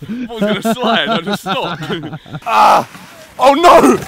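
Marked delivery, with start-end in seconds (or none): 2.5-4.0 high loud panicked shout. Real, not acted. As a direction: yell out as you slide. Sliding, losing control.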